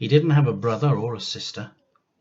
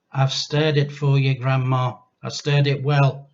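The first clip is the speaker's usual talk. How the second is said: put-on boy's voice; 4 semitones higher, 7 dB louder than usual. George answers grandma.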